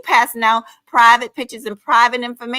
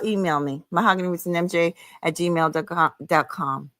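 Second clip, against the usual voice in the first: ordinary delivery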